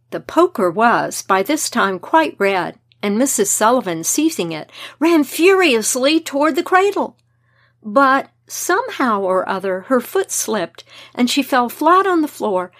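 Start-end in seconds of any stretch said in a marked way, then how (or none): none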